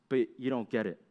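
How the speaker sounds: ordinary talk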